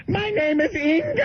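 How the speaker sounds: very high-pitched mock shriek; horrified old lady. Horrified old lady fleeing Bill, very high mock shriek. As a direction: imitate a frightened woman without raising the volume. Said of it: "she turns around and goes".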